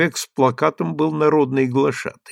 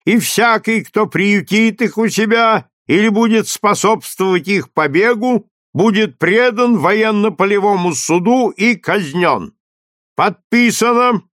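loud, higher stern proclamation; town crier; 8 semitones higher, 4 dB louder than usual. A narrator's reading. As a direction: proclaim the sentence to the square. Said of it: "Глашатый продолжал".

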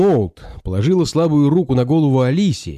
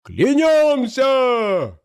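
very high, long-held cry; soaring, slow shout of allegiance; crowd's oath; 13 semitones higher, much slower than usual. A narrator's reading. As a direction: stretch the vow out even higher, echoing through a hall. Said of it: "прокатилось по залу".

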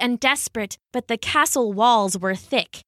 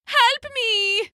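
high-pitched desperate plea; panicked cry for help; 12 semitones higher than usual. Acted. Haddo with mice squeaking after him, panicked plea. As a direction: cry it out high, stretched with fright. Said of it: "Haddo cried".

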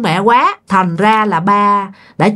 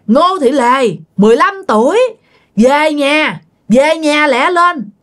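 calling out; 6 semitones higher than usual. Retold or imitated. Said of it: "Tía lên tía kêu nha".

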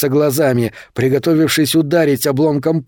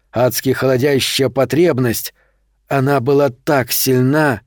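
ordinary delivery

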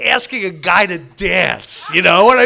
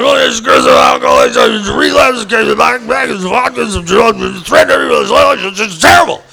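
loud, garbled stream of fragments; panicked rant; 6 dB louder than usual. Retold or imitated. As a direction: spill it out loudly, stumbling over the words. Acts out his panicked share.

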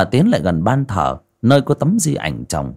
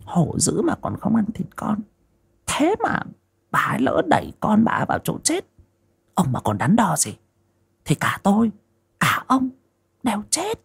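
much higher, hushed insistent wife; pressing wife; 11 semitones higher, 3 dB quieter than usual. Acted. Wife urges her hesitant husband to kill his mother, high and hushed. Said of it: "con Hoa lại bồi thêm".

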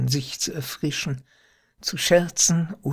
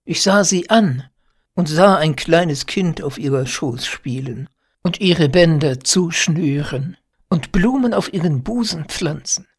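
plain reading voice

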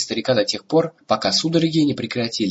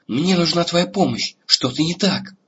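higher, urgent call for help; urgent plea; 7 semitones higher than usual. Acted. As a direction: plead for help in alarm. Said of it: "звала Сапфира". (встревоженная мольба)

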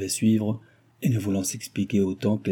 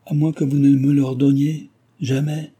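louder, higher, fervent plea; eager outcry; 6 semitones higher, 6 dB louder than usual. Acted. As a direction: burst out with longing. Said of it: "s'écria-t-il vivement". (s'écrier vivement, plus fort et plus aigu)

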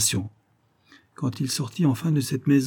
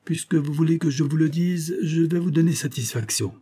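raised-pitch retort to a challenge; formal confrontation; 6 semitones higher than usual. A narrator's reading. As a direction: answer the challenge, voice lifted. Duke answers the banker's challenge.

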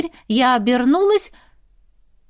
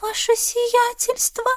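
small, high, slow voice; frightened; 12 semitones higher, 3 dB quieter, slower than usual. A narrator's reading. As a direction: say it in awed fear, pitched high. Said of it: "взглянула на меня со страхом".

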